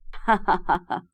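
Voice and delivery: acted man's laugh, low; staged belly laugh; hearty laugh. A narrator's reading. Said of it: "فضحك سلطان بقوة".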